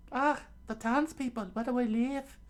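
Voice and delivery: very high falsetto with a smile; smiling Nami voice. A narrator's reading. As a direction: sound relieved in a high girl's voice. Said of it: "Nami said with a smile".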